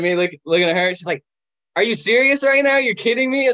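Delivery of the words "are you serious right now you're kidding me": much higher, louder indignant outburst; fed-up nurse snapping at a kid; exasperated nurse. Retold or imitated. Nurse exasperated at kid's answers.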